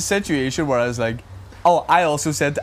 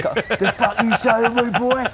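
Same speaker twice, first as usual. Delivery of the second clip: slow, higher hammed-up London hard man; Frank Butcher impression; 8 semitones higher, much slower than usual. Mimicking a street threat to his mate as a hammed-up Frank Butcher. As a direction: drag it out like a mock soap-opera tough guy. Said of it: "remember Frank Butcher in EastEnders? He went".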